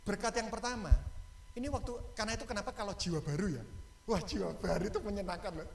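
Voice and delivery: ordinary talk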